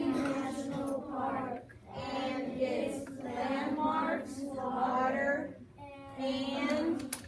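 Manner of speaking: very slow, loud read-along; loud, stretched-out group reading; reading in unison. Shown as a demonstration. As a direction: draw each word out for the class to join. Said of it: "voices together".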